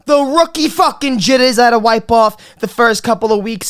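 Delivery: ordinary talk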